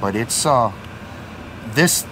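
usual speaking voice

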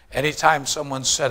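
usual speaking voice

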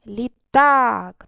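«Літак» із гучним, протяжним «так»; виділи голосом другий склад у «Літак»; наголос на другому складі. Shown as a demonstration. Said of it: "На який склад падає наголос". («Літак» з наголосом на останньому складі, гучно й протяжно)

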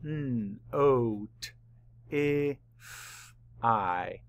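"N, O, T, I, F, I" as separate letter sounds; sounded out. Shown as a demonstration. Teacher mapping the sounds of notify, sounded out. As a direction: hold N and F, short first I, long last I. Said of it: "Map the sounds".

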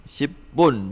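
"Shipun" split as ship, then a strong bun; syllable by syllable. Shown as a demonstration. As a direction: pronounce "Shipun" in two separated syllables. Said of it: "đọc nhanh là Shipun".

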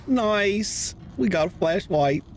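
high, pleased squeal of success; excited. Real, not acted. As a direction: cheer it out, slowly, very high. Just crafted a flashlight.

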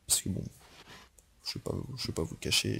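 quick, nervous whisper. Real, not acted. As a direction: whisper the confession, jittery and quick. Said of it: "j'ai extrêmement le trac". (chuchoté, nerveux et rapide)

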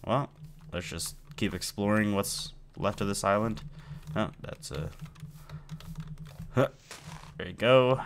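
ordinary talk

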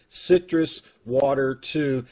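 normal talking voice

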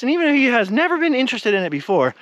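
normal talking voice

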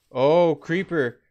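loud, drawn-out shout of alarm; startled cry. Real, not acted. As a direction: yelp at the sudden creeper. Sudden creeper in game.

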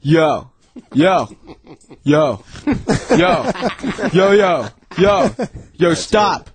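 one-word bark repeated with gaps; mock earnest amateur acting; imitated bad take. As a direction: deliver each as a separate dead-serious take. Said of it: "he seriously says in each take".

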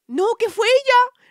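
very high, loud squeak; mock blaming boyfriend. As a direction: squeal it, shifting the blame. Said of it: "y dice el cacahuate".

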